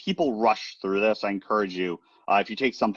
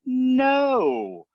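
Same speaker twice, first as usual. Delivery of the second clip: pushing back; 11 semitones higher, slower than usual. Retold or imitated. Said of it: "I'm like".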